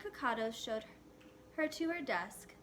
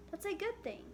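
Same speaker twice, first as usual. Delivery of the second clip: high, lightly voiced mother; 9 semitones higher than usual. A narrator's reading. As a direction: reassure her gently.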